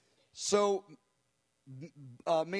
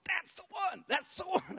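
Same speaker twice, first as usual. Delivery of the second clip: much higher, eager exclaim; it's her, it's her!; excited confirmation; 12 semitones higher than usual. Retold or imitated. Bob eagerly confirming the prophet picked the right woman.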